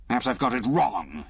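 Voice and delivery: dry, sarcastic concession. A narrator's reading. Lemus mocking Liz's argument, sarcastic. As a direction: say it with mock humility. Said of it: "he added sarcastically".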